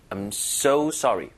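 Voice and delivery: I'm so sorry said very slowly, so leaned on; drawn-out so with a light push; slow stress on so. Shown as a demonstration. Teacher repeats the phrase slowly with stress on so. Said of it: "보다 힘을 살짝 주는 거예요".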